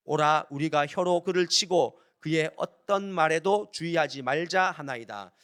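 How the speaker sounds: loud, slow congregational Bible reading; projected, deliberate recitation of scripture. Acted. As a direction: proclaim the verse firmly, phrase by phrase. Leads congregation in reading verse.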